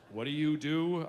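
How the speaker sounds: flat, bored polite question; bored small talk. Retold or imitated. To the other dad, a bored polite question.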